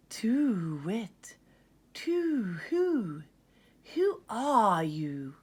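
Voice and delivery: owl hoots, then slow question; hooting owl. Acted. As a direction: hoot it from a high branch. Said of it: "hooted a voice".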